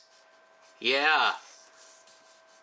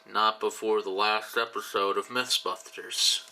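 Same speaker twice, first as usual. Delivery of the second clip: lazy walrus voice; 5 semitones lower than usual. Acted. Walrus answers the yelling commander.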